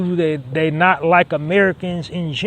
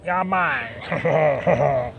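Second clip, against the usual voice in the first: mock French accent on "Yeah, man."; bad French accent; 3 semitones lower, 3 dB quieter than usual. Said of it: "That's a bad French accent".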